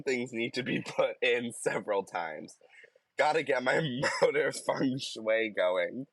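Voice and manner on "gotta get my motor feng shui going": usual speaking voice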